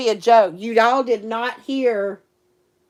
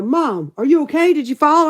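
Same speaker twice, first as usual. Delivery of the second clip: put-on mildly higher, louder worried kid calling out; worried daughter; 5 semitones higher, 5 dB louder than usual. Jazzy calling to mom after a crash, lightly voiced worry. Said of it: "Jazzy will come running out and go".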